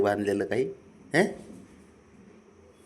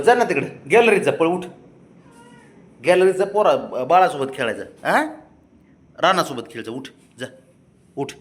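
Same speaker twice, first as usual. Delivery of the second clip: ordinary talk, nothing marked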